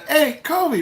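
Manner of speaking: high, eager call across a crowd; calling out to Kobe. Retold or imitated. Fan calling out to Kobe Bryant as he passes, high and eager. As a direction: shout his name to catch his eye.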